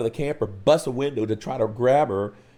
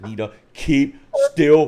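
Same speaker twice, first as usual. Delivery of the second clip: urgent warning; 7 dB louder than usual. Retold or imitated.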